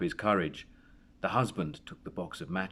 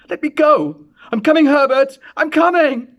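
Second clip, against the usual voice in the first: much higher, much louder desperate shriek; frantic scream; 15 dB louder than usual. A narrator's reading. Mother calling to her dead son, screaming. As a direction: scream it toward the door as you struggle. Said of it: "she cried, struggling".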